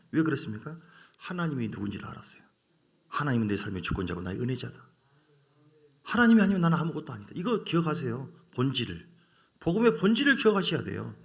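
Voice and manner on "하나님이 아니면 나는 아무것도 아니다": ordinary delivery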